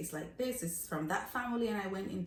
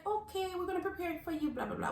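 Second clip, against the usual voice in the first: high, softer sing-song reply; mock obliging server, pitched up; agreeable restaurant lady; 10 semitones higher, 5 dB quieter than usual. Lady promising to prepare the order, agreeable restaurant lady.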